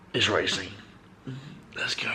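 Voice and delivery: excited whisper, very soft. Real, not acted. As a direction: hush it out like a secret. Hushed hype before sleep.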